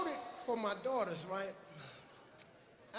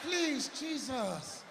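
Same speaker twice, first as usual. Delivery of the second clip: 1 pleading prayer; 2 semitones higher, much slower than usual. Retold or imitated. Host pleading to heaven.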